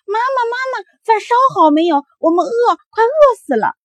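put-on hungry kids bursting in and yelling for dinner; children yelling at home for their supper; shouting children. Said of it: "一回家来就嚷嚷".

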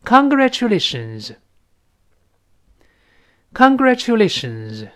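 lifted, drawn-out teacher's demonstration; read-along model. Shown as a demonstration. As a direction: stretch "congratulations" out for learners. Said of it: "跟老师再读一遍".

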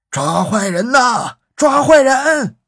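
urgent shout. A narrator's reading. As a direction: call out the alarm, stretching each word. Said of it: "他一边喊".

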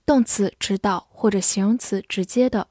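ordinary talk

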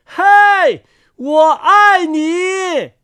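high, long-held hailing shout; loud call. A narrator's reading. Words flying over a rainy forest, calling loudly. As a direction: shout it out high, drawing out each word. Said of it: "大声说".

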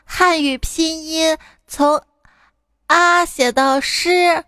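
put-on high, very slow drawn-out child; earnest kid stretching each syllable. Child answering mother's homework question, high drawn-out kid voice.

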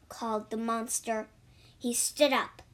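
plain reading voice

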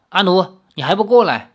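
scolding call. A narrator's reading. Gai Luojiao scolding Anu to come over, sharp call.

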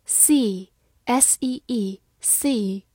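letter by letter, very slow; slow spelling. Shown as a demonstration. Teacher spelling a word slowly for students. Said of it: "spelling demo".

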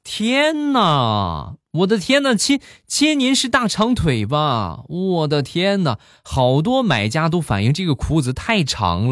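high-pitched, hammed-up amazement; put-on seller voice, high and effusive; gushing online seller. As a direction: flatter the customer with exaggerated astonishment. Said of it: "卖家当时就说".

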